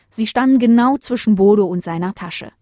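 plain reading voice

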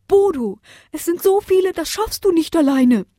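high, anxious owl voice; worried. Acted. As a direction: fret for Bodo. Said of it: "sorgte sich die Eule".

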